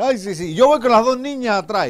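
high, loud excited wife; mujer entusiasmada. Acted. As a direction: chime in high and eager. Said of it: "dice la mujer". (esposa emocionada, voz aguda)

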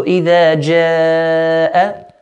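long stretched aa in جَاءَ, held four counts; madd elongation. Shown as a demonstration. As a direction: stretch the aa of جَاءَ, then a clean hamza. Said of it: "this type of mad is called mad wajib muttasil".

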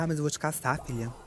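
usual speaking voice

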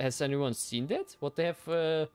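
normal talking voice

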